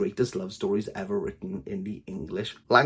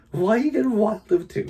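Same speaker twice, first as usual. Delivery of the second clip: mock exasperation; 9 semitones higher than usual. Real, not acted. Mocking offended readers.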